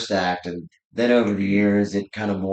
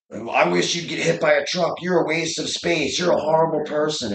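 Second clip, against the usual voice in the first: higher, louder sneering hateful troll; parodied online hater, pitched up and nasty; mock troll insult; 7 semitones higher, 3 dB louder than usual. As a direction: ham up a basement keyboard warrior spitting insults. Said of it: "and they're like".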